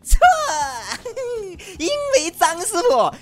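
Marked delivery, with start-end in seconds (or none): 0.2-1.7 triumphant laugh. Real, not acted. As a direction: whoop at the win.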